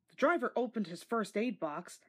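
usual speaking voice

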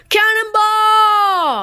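high, slow shouted cannonball; cannonball yell. Acted. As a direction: yell it mid-jump. Jumping into pool.

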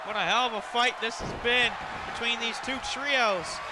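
high, excited play-by-play calling; wrestling commentator. Acted. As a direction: call the big moves hyped up, ringside. Live play-by-play of a wrestling match.

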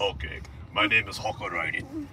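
low, soft put-on character voice; another put-on accent. Character introducing himself, low experimental accent. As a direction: try out a deep, hushed accent. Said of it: "with the impressions and accents".

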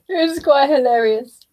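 usual speaking voice

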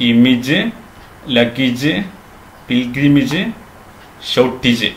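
ഇമേജ്, ലഗേജ്, പിൽഗ്രിമേജ്, ഷോർട്ടേജ് all ending in short "-ij"; correct pronunciations. Shown as a demonstration. Teacher recapping, short "-ij" on ഇമേജ്, ലഗേജ്, പിൽഗ്രിമേജ്, ഷോർട്ടേജ്. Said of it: "അപ്പൊ പറയേണ്ടത്".

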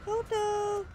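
high call of the name. Real, not acted. Player calling Koto back, high loud call.

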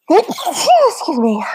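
loud, high real sneeze into an apology; sneezing. Real, not acted. Presenter interrupted while reading the text, sneezing. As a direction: sneeze mid-sentence and excuse yourself. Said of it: "I'm having a little fit now with sneezes".

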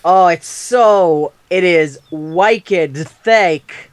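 put-on slow, drawn-out Boston accent. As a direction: lay on a thick Boston accent. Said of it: "your thick Boston accent is throwing me off".

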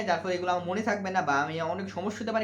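ordinary talk

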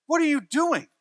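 sharp high stern father question; stern dad. Retold or imitated. Dad confronting his little girl, mock-stern. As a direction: catch the kid out of bed.

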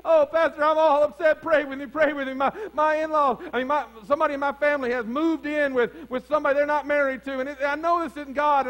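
imitating a fretful churchgoer, high and loud; mock worried plea; upset congregant. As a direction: play the anxious member begging for prayer.

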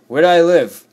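high, loud panicked question; frantic shout. Real, not acted. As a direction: cry out, lost and chased. Lost while being chased.

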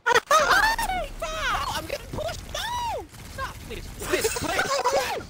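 high, frantic begging; panicked pleading. Real, not acted. Getting pushed, pleading in game.

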